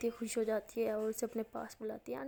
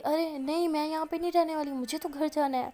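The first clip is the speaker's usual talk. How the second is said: higher, louder mock refusal; retold stubborn objection, raised voice; acted protest; 5 semitones higher, 6 dB louder than usual. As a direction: protest insistently, voice pitched up. Patient refuses to stay.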